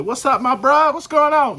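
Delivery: greeting a buddy. Retold or imitated. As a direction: hype up your bro on the street. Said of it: "one of my fellows".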